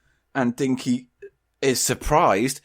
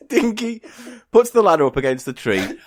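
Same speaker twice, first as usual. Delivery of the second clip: ordinary talk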